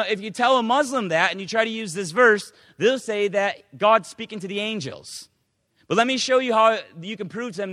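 ordinary delivery

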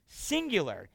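ordinary talk, nothing marked

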